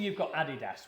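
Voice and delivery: ordinary delivery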